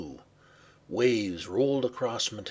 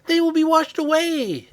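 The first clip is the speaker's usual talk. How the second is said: very high, loud wail of alarm; alarmed cry; 11 dB louder than usual. Acted. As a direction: cry out in panic for the animals. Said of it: "Abby cried".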